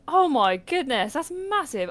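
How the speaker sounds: high, slow amazed gasp. Retold or imitated. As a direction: marvel at its size. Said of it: "I was like".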